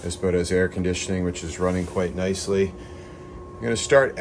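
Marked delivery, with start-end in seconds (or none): none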